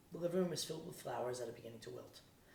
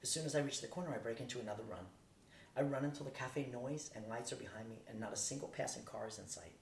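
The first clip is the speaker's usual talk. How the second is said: ordinary delivery, nothing marked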